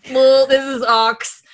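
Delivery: lightly mocked prince, loud and drawn out; slow, high, loud mock dismay; Charles. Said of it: "And Charles is like".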